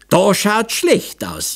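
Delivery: lamenting. A narrator's reading. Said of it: "jammerte Franzl".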